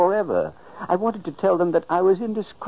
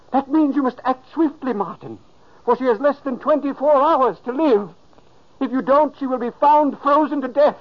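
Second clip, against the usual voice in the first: urgent warning; 7 semitones higher, 4 dB louder than usual. Acted.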